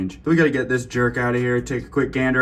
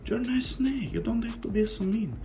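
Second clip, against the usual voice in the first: high pleading pet voice; baby talk to pet; 12 semitones higher, 4 dB quieter than usual. Acted. Keeper sweetly begs the snake not to bite.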